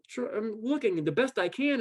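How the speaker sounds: usual speaking voice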